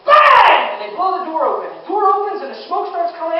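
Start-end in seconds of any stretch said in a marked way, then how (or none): 0.0-0.8 loud, high imitated bang. Door hit with an axe, loud imitated bang. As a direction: slam the sound out.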